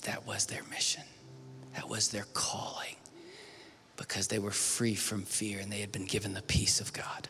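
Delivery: hushed, reverent whisper. Acted. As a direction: lean in and whisper it. Sermon turns intimate and hushed.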